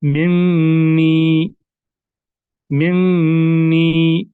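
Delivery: मिन्नी stretched slow with a humming nasal न्न; nasalized recitation demo; nasal ghunna. Shown as a demonstration. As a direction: hum the doubled न of मिन्नी through the nose. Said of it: "इसी तरह".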